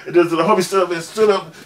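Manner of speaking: normal talking voice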